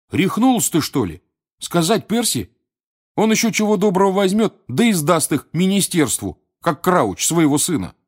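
высокий, громкий возмущённый возглас; возражать с жаром, как другу, сказавшему глупость; возмущение. Acted. Рон возмущён советом рассказать Перси. (возмущённо, на повышенных тонах)

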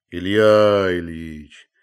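loud, drawn-out call to a sleeper; insistent, stretched-out wake-up call; insistent repeated call. A narrator's reading. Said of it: "твердил Захар".

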